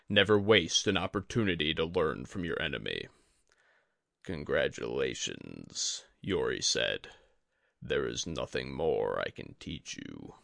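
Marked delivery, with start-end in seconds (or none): 4.2-6.0 low, slow, grave teacher's voice; a dying sensei, calm and heavy; Yori the old master. A narrator's reading. Yori to his student. 7.8-10.3 a dying sensei, calm and heavy; Yori the old master. A narrator's reading. Yori to his student.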